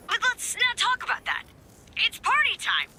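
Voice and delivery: high, upbeat brush-off; eagerly announcing the fun; party excitement. Acted. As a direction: switch into high-pitched party mode.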